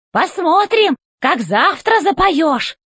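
high, slow, commanding robot boss; robot chef voice. A narrator's reading. Robot chef demanding answers from the old robot, high and commanding. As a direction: bark orders from up high like a tin general.

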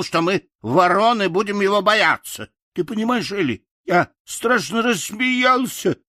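a simple, friendly scarecrow telling his tale. Acted. (добродушный голос Страшилы)

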